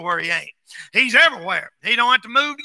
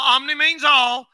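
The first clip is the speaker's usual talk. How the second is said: normal talking voice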